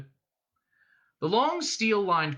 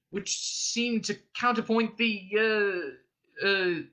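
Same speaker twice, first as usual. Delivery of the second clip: higher, halting search for words; floundering; 7 semitones higher, slower than usual. A narrator's reading. Arthur bluffs a poetry critique, floundering. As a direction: stall on a raised pitch, groping for the next word. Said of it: "he floundered".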